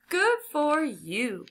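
high, drawn-out hearty congratulation; delighted cry. A narrator's reading. Doctor congratulating Henry on his win, delighted cry. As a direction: congratulate slowly with pitch lifted. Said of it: "cried Doctor Moore".

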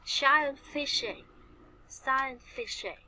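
slow, carefully spaced dictionary-style delivery; slow model reading. Shown as a demonstration. Teacher modelling a new term for students, slowly. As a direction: say it slowly for learners to repeat.

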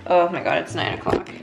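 ordinary talk

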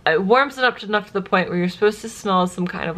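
usual speaking voice

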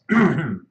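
harsh throat clear. Real, not acted. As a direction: clear the throat before going on. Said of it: "excuse me".

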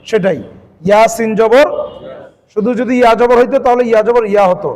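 ordinary delivery

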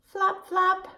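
lightly squawky high parrot voice; parrot squawk. Shown as a demonstration. Teacher playing a parrot for the class, lightly squawky. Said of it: "fly around the room like a parrot".

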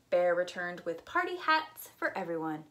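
plain reading voice

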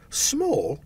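sharp high disbelieving "Small?"; incredulous echo. Acted. Scrooge echoing the ghost, incredulous and high. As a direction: throw the word back in disbelief. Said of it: "echoed Scrooge".

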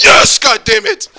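loud, slow exasperated shout; frustrated shout. Retold or imitated. Answering the agent who can't hear him, loud slow shout. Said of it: "I'm like".

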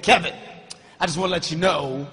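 low, slow, threatening uncle voice; menacing uncle. Retold or imitated. Uncle vowing revenge at the funeral, low menacing voice.

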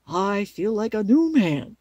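loud, slow scarecrow exclamation; relieved scarecrow. Acted. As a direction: stretch it out with great relief. Scarecrow freed from the pole.

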